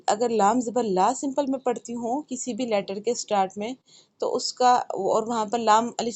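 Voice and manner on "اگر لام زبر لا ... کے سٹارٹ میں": ordinary talk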